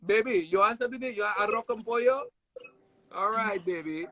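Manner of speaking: imitated Panamanian mom. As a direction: coax a guest to eat in a high motherly voice. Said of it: "my mom was always feeding everybody".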